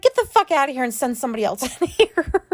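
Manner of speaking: high, fast angry outburst with a laugh; retold losing control. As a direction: re-enact a meltdown at the nurse. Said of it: "I've never lost control like that".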